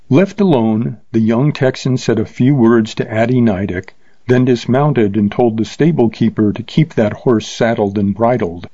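plain reading voice

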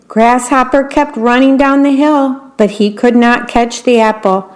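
ordinary delivery

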